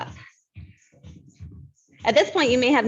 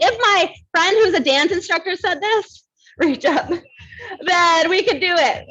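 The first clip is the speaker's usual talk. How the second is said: laughing; 9 semitones higher, 3 dB louder than usual. Retold or imitated. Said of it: "I was like".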